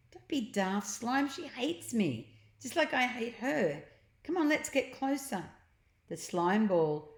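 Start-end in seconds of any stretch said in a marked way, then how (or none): 0.1-5.4 put-on eager boy, higher and quick; Ned, eager boy. A narrator's reading. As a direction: push the plan on, excited. Ned voiced.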